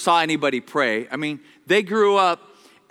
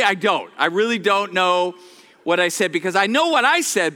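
ordinary delivery

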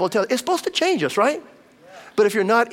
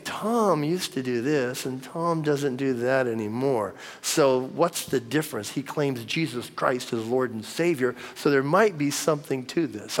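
musing onlookers; 5 semitones lower, 5 dB quieter, slower than usual. Retold or imitated. Imagined acquaintances puzzling over his change.

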